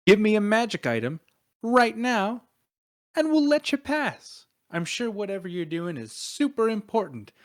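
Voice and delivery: acted bully's demand; pushy demand. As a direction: press them for payment, easing off at the end. Acted shakedown for magic item.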